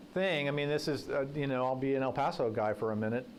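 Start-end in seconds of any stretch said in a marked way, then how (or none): none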